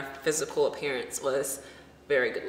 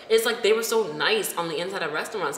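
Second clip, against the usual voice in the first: usual speaking voice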